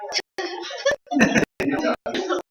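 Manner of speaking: a high giggling squeal; high squeal of laughter. Real, not acted. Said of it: "laughs at own impatience".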